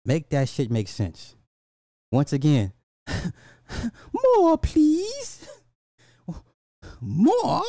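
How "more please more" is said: high squeaky voice begging for more; high mock plea. Commentator teasing the audience, squeaky high pleading.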